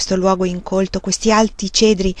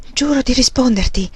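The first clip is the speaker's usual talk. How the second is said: usual speaking voice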